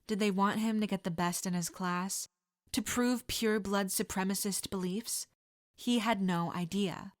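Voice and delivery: usual speaking voice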